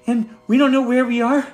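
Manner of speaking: put-on a young gnome bursting with panic and relief; frantic gnome. Young gnome finding the party at dawn, frantic high cries. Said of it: "the gnome comes up and says".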